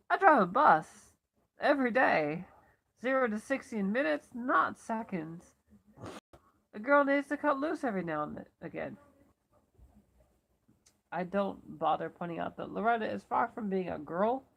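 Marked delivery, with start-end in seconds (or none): 0.1-9.0 higher, louder exclamation, proudly announced; exuberant boast. A narrator's reading. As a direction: crow it to a staring audience. Loretta boasts to staring passengers.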